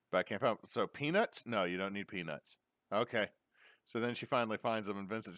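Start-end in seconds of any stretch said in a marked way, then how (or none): none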